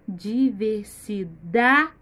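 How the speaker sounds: "Diversidade" slowly split, loud on "da"; stressed syllable by syllable. Shown as a demonstration. Teacher quizzing children on syllable position, "Diversidade" split with stressed "da". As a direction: punch "da", with every syllable of "Diversidade" separate. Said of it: "Qual é a sílaba mais forte?".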